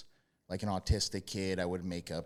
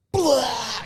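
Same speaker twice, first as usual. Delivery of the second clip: high, loud mock slam yell; ring-style war cry; wrestler's roar; 14 dB louder than usual. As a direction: belt out the impact sound. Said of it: "like a Stone Cold Stunner".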